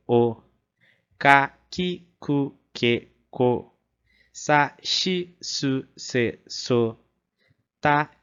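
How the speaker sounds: each syllable read out clearly, one by one; reciting the kana chart. Shown as a demonstration. Teacher drilling students through the hiragana chart. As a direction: recite the chart slowly for learners.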